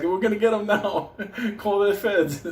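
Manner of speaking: high, laughing mock excitement; a laughing, high-pitched imitation of people sure they've got you; mocking the chasers. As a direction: squeal it like giddy cops on the hunt. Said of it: "They're like".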